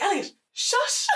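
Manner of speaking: high, drawn-out shushing cry through laughter; half-laughing squeal to hush someone; mock urgent shushing. Acting out her scolding of Elliot.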